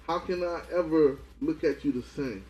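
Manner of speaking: ordinary delivery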